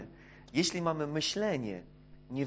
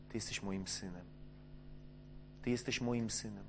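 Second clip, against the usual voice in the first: soft, low, much quieter; tender father; 6 semitones lower, 12 dB quieter than usual. Acted. Father welcoming his returning son, softly and tenderly. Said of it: "ojciec pierwsze co robi?".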